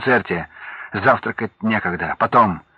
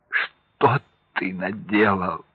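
slow, softer, lower sobbing question; 3 semitones lower, 4 dB quieter, much slower than usual. Acted. Wife sobs into her husband's shoulder, slow and soft. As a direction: weep it into his shoulder. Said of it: "уткнулась головой в его плечо и зарыдала".

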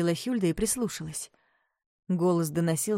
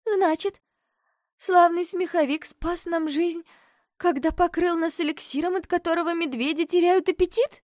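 put-on high, hopeful girl; girl's voice; 11 semitones higher than usual. A narrator's reading. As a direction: ask it, relieved.

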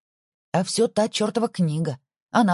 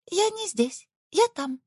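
very high squeaky imp voice; tiny cartoon voice; 13 semitones higher, slower than usual. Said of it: "тоненький голосок".

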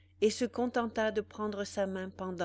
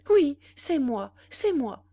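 loud, distraught and breathless; frantic cry; 7 semitones higher, 10 dB louder than usual. A narrator's reading. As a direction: cry it out, beside yourself. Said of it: "dit Bénédicte éperdue".

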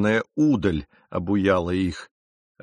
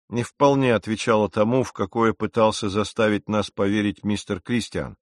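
usual speaking voice

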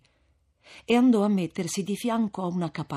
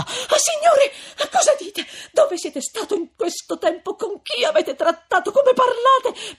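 very high, loud tearful voice; frantic shocked questions, pitch soaring; horrified outcry; 7 dB louder than usual. A narrator's reading. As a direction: cry out scandalized, building to a wail. Lucia horrified at Renzo's words.

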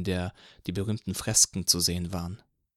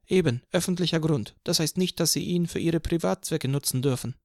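higher lecturing cop; officer lectures about public ground, higher pitched; policeman arguing; 7 semitones higher than usual. A narrator's reading. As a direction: lecture him firmly.

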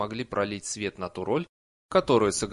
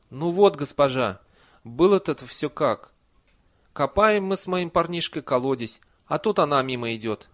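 louder, slightly raised peasant voice; earnest villager storyteller; 4 semitones higher, 7 dB louder than usual. A narrator's reading. Villager explains the well digging to the lady, earnest. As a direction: lay out the tale for the mistress.

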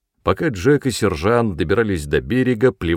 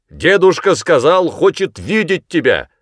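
loud, raised-pitch call; 8 semitones higher, 6 dB louder than usual. A narrator's reading. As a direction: shout a message over distance. Sergeant calls across the river. (громко кричит издалека)

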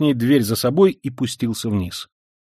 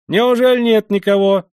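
high, loud, merry call up the stairs; ringing cheerful shout; 9 semitones higher, 6 dB louder than usual. A narrator's reading. Young man calls up to the locked door, ringing and cheerful. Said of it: "звонко и весело закричал подошедший".

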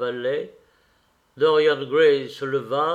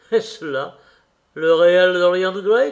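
louder, projected question; crying out; 3 semitones higher, 8 dB louder than usual. A narrator's reading. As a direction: call it out, building in volume on the name. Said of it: "cria l'original du portrait". (question lancée d'une voix forte)

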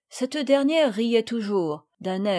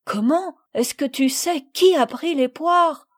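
higher, louder incredulous question; astonished; 5 semitones higher, 6 dB louder than usual. A narrator's reading. Madeleine questions Camille about the pears, astonished. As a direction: ask in sharp surprise.

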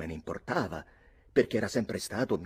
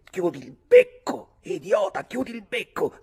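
high, very loud scolding; angry silencing; 12 semitones higher, 10 dB louder than usual. A narrator's reading. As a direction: shut him up with a furious outburst. Ulick silencing the narrator angrily.

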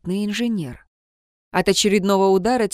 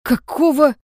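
indignant; 6 semitones higher, 6 dB louder than usual. A narrator's reading. Jun shouts in disbelief.

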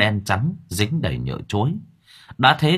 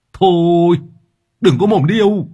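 higher, louder angry yell; shout; 8 semitones higher, 7 dB louder than usual. A narrator's reading. Driver Hà shouting the liar away, angry. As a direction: bark him away.

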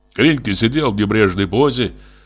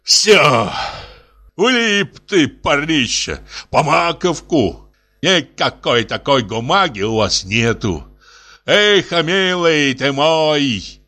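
gloating; 5 semitones higher, slower than usual. Acted. Гринько gloats, caught Кузьма.

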